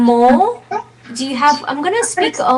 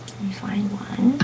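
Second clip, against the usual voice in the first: whispering; 2 semitones lower, 11 dB quieter than usual. Real, not acted. Teacher muttering while searching.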